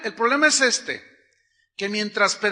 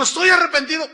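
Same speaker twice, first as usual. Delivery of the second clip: mock weeping; 8 semitones higher, 8 dB louder, faster than usual. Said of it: "Lloró y dijo".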